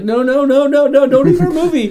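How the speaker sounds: high, loud frantic pleading; panicked scrambling, played for laughs; mock panic. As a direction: cry out in comic alarm. Acting out studios fleeing Star Wars.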